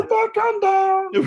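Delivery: a mocking falsetto Batman; squeaky high Batman. Mocking the Batman voice [name] called too high, in squeaky falsetto. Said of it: "it was weird when he was like".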